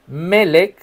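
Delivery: Melech stressed on ME; accent the first syllable of Melech. Shown as a demonstration. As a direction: say Melech as MÉ-lech. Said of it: "acento en la primera E".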